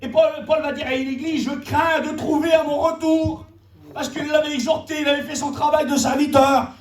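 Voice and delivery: high-pitched, driving sermon voice; impassioned preaching. Real, not acted. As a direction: proclaim the apostle's warning with urgency. Preaches on Paul's letter. (prédication ardente et pressante)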